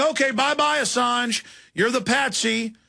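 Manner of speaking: high, dismissive send-off in others' voice; imitated brush-off, pitched well up; mock farewell. Mock send-off quoting the plotters.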